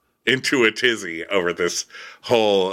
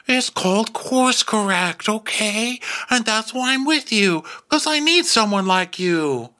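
mimicking him; 9 semitones higher than usual. Retelling the boyfriend's excuse, mild high mocking impression. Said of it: "He goes".

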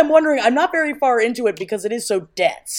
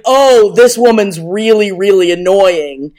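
loud, slow, fed-up drag on really; a mock-irritated complaint; exasperated quote; 8 dB louder, slower than usual. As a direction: groan it out like she's had enough. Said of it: "it's like".

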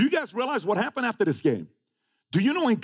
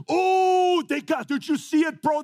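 mock gloating hater; 8 semitones higher, slower than usual. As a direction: crow like a fan who loves the hit. Haters celebrating his hit.

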